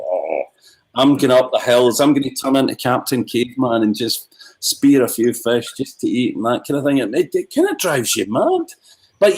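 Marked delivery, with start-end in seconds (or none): 0.1-6.4 fed-up self-talk. Retold or imitated. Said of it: "you go".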